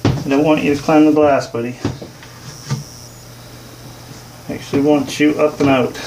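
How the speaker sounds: muffled behind a face mask; talking through a mask. Real, not acted. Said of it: "If it sounds funny, it's because I got a mask on my face".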